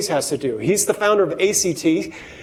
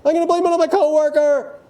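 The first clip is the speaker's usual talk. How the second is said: reactive panic; 10 semitones higher than usual. Retold or imitated. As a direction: rush to blame everyone in a panic. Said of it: "you're getting reactive. You say".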